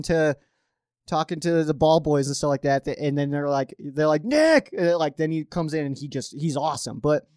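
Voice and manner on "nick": high, loud imitated shout for a player; sudden raised call. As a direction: holler the name across a sideline. Acts out coaches calling the backup.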